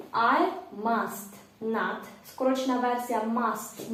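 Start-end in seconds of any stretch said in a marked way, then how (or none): 0.1-2.0 slow model phrase. Shown as a demonstration. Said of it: "ставимо not".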